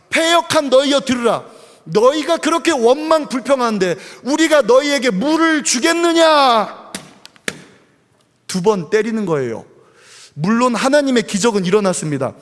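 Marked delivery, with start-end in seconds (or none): 0.1-6.9 commanding rebuke. Retold or imitated. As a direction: berate the grumbling crowd from on high. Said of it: "사람들 앞에 가서 호령합니다".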